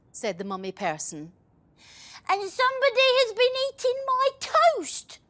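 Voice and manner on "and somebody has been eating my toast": put-on very high child's voice; little child voice. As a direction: squeak it like a small upset child. Little person complains.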